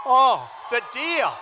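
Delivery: loud, slow, savoring "Oh, the deal"; relishing exclamation. Real, not acted. To the crowd, savoring his deal, loud and slow. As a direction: draw out "Oh" with gusto.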